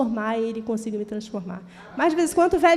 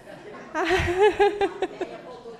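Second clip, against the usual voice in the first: embarrassed laugh; 7 semitones higher than usual. Real, not acted. Laughs at her own outburst.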